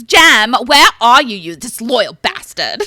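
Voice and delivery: put-on shouted line in a rough Essex accent. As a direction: yell it like a cheeky Essex lad. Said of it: "now do it in an Essex accent".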